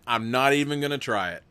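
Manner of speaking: ordinary talk, nothing marked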